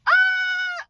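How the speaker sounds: piercingly high squeal of joy; happy squeal. Real, not acted. Vlogger overjoyed by her magazines, happy squeal. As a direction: let out a squeal of pure excitement. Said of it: "I'm so happy".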